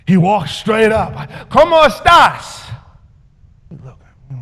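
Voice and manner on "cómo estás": loud, high, slow Spanish; overconfident greeting. Acted. Teller greeting a new customer, overconfident. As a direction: hail him with far too much gusto.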